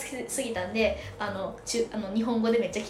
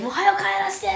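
higher, louder hammed-up whine to go home; mock desperate plea; 9 semitones higher, 6 dB louder than usual. Telling a friend she just wanted out of Thailand, hammed-up plea. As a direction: beg to be sent home, half-joking.

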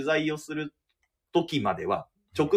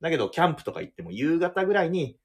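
ordinary delivery

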